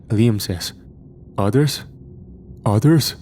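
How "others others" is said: rising, alarmed repeat. A narrator's reading. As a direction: ask again, sharper and louder.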